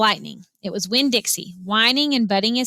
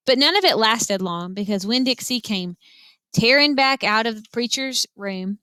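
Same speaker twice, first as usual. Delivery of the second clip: ordinary delivery, nothing marked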